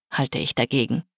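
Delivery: ordinary delivery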